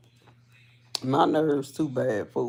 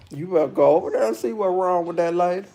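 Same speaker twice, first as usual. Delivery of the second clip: hurried warning; faster than usual. Retold or imitated. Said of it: "retold warning to daughter".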